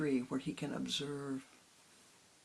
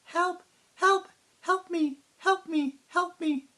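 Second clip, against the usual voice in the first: much higher, louder panicked cry for help; child crying for help; 11 dB louder, slower than usual. Acted. Little girl frozen on the tower calls for help, terrified.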